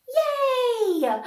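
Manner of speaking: high, loud laughing cheer; cheering yay. Acted. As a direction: cheer with the kids over the rescue. Celebrating the rescue with kids.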